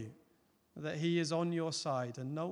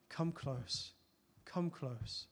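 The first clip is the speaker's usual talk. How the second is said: tender invitation; 3 semitones lower, 9 dB quieter, much slower than usual. Acted. God's tender invitation voiced.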